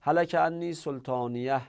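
سلطانیه ending in "-iyah"; fatha ending. Shown as a demonstration. Teacher has students compare which is easier, fatha ending. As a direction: say سلطانیه with an open a before the final h.

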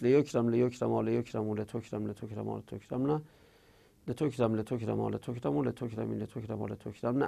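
fast drill, voice sinking away through the list; rapid conjugation. Real, not acted. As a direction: rattle off the verb table by rote.

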